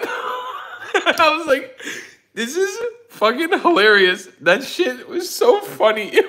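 high, slow, laughing helplessly; wheezing with laughter, real; laughing hard. Real, not acted. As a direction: barely get it out through laughter.

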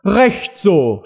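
high cry of approval; shouting queen. A narrator's reading. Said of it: "schrie die Königin". (rufende Königin)

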